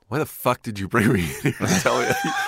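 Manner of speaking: comic exasperation, loud and hurried by the end; exasperated protest. Retold or imitated. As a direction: protest in disbelief, breaking into a laugh. Retold protest at the doctor.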